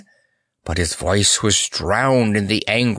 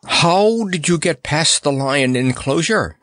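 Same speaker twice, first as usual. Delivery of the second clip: higher-pitched, insistent questioning; demanding; 7 semitones higher, 3 dB louder than usual. A narrator's reading. Courtier interrogates the clown about the lion enclosure, pitched high and demanding. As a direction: demand an explanation. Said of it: "demanded Panapee".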